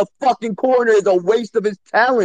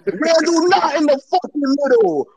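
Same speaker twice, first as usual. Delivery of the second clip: high-pitched, exasperated real yell; upset yelling; 7 semitones higher than usual. Real, not acted. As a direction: yell in outraged disbelief, dragging the last words. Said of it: "Don't yell".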